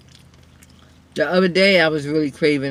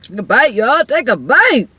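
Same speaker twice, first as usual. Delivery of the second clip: excited urging; 6 semitones higher, 4 dB louder than usual. Real, not acted. Repeats invitation, louder and higher.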